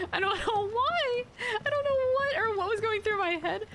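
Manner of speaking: high-pitched embarrassed squeal, close to cracking up; mortified, giggly high voice; embarrassed laughter. Real, not acted. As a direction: fight real giggles while confessing, voice shooting up. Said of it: "it's so embarrassing".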